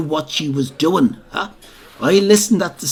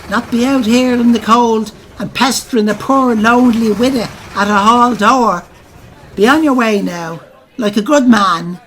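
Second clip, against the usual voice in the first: coy send-off; 5 semitones higher, 3 dB louder than usual. Retold or imitated. Widow seeing off the officer.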